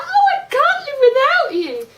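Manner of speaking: high, loud mock-lovesick wail; hammed-up pleading in a girlish pitch; crying little girl. Mocking her reaction to being dumped, crying little girl voice. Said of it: "crying, annoying little girl".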